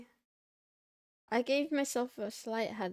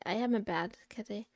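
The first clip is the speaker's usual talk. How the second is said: normal talking voice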